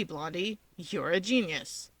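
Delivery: usual speaking voice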